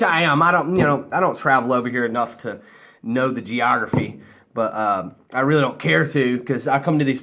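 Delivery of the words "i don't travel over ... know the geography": ordinary talk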